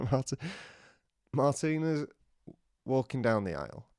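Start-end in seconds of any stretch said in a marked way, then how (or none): none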